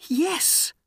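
high-pitched princess. A narrator's reading. Princess answers Aladdin about the lamp, high princess voice.

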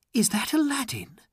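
high, quick, surprised voice; light, high female character voice; princess's wondering thought. A narrator's reading. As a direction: wonder hopefully to yourself. Princess wonders on hearing him.

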